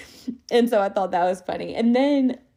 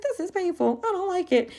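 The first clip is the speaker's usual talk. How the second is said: mock complaint; 12 semitones higher than usual. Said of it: "I was like".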